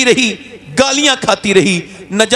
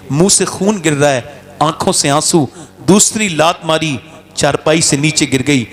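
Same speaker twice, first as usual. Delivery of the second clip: usual speaking voice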